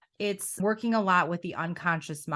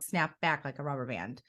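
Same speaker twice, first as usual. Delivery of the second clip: normal talking voice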